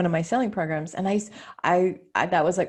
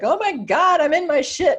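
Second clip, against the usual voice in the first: overwhelmed client; 8 semitones higher, 6 dB louder than usual. Retold or imitated. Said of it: "my clients are like".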